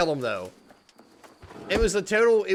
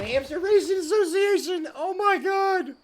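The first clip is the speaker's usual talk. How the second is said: much higher, squealing with glee; excited squeal; 13 semitones higher than usual. Real, not acted. Spelling out the hamster racing acronym, an excited high squeal. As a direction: squeal it out in delight. Announcing joke acronym, cracking up.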